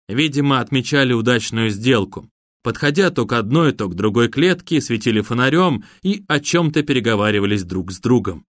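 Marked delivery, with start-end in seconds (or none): none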